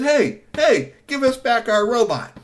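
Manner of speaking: put-on high, loud kid's demand. Three T demanding the men give back the robot, high and loud. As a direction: insist on getting the robot back.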